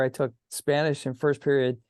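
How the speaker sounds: normal talking voice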